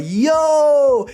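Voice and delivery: long, high, loud holler; shouted amazement. Acted. Streamer reacting to the story for chat, hyped shout.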